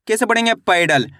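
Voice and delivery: normal talking voice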